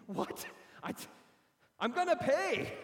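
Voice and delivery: high, loud, panicked promise to pay; hammed-up terror at the thugs; mock panic. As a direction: squeal it out like a scared man caught by thugs. Frightened taxpayer caves.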